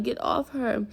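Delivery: normal talking voice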